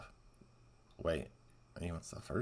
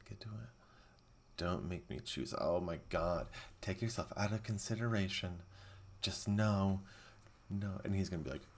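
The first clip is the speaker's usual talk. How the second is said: usual speaking voice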